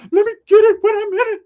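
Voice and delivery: very high, loud comic squeal; imagined cousin voice. Retold or imitated. Cousin with the girl as imagined, high loud squeal. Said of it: "I can imagine him".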